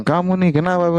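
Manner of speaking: a hint of an irritated husband; annoyed husband. Husband questioning his wife after she was cheated, mildly irritated. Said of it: "Terus suami bilang".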